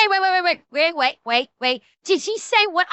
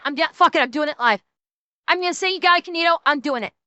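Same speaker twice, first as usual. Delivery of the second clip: usual speaking voice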